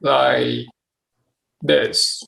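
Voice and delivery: long pauses between words; measured, halting demo of someone's pace; demonstrating slow speech. Shown as a demonstration. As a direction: space the words far apart. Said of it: "I can demonstrate how fast you talk".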